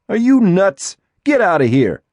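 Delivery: high, loud angry yell; angry shout. Acted. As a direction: shout him off in fury. Boy shouts at Eddie.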